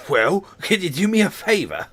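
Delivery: badly faked California accent, high and loud; attempted California accent. Man asks a kid a favour, botched California accent. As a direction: have a shaky go at a California surfer accent. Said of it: "that's not even California".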